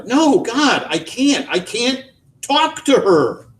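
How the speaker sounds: high, slow anguished refusal; despairing. A narrator's reading. Son refusing father, high and anguished. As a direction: let it break out.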